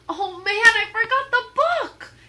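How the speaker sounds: high, loud sudden dismay; alarmed exclamation. Acted. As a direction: realize the mistake out loud. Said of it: "he said".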